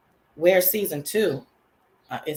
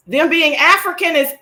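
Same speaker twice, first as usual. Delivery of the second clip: fast, loud, high rave; loud fast delight; excited; 9 semitones higher, 10 dB louder, faster than usual. Real, not acted. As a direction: shout it out in joy. Raving about the show.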